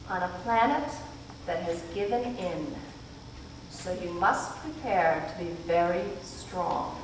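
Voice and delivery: quiet but resolute; low firm voice. A narrator's reading. Missus Watson warning the children, low and firm. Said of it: "voice that was low but quite firm".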